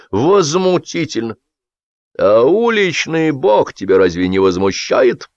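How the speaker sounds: drawn-out, heated outrage; indignant. A narrator's reading. Boss egging on his staff against a poem, indignant. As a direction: stoke the indignation. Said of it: "поддал жару Агап Палыч".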